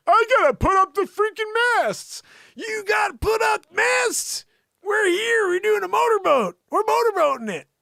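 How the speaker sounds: high, loud mock yelling; hammed-up shrieking squabble; mock shouted argument. Acting out a boat argument.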